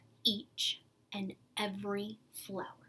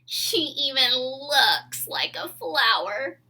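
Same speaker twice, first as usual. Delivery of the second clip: slow, loud, teasing sneer; mocking; 3 semitones higher, 15 dB louder, slower than usual. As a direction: drag it out to mock her. Girls taunt Chrysanthemum.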